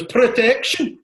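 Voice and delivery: loud high cry; mock protest shout. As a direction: bellow it like the kid again. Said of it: "his face when he goes".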